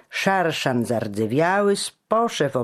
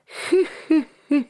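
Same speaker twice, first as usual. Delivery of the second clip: very high amazed gasps; astonished; slower than usual. A narrator's reading. Fabio marvels at Giano's boast, astonished. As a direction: gasp out in wonder. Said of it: "zadziwił się Fabio".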